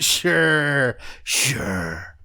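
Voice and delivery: drawn-out, doubting agreement; sarcastic disbelief. Real, not acted. Host doubting the car accident story, sarcastically agreeing.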